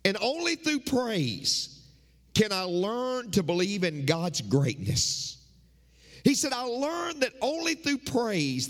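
rhythmic pulpit preaching, rising and falling; sermon cadence with repeated refrains. Acted. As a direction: preach it to the congregation.

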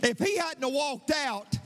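high, rising preacher's climb over music; fervent pastor near singing; impassioned preaching. Acted. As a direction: build the sermon to its peak, lifting pitch. Preacher's climax over organ.

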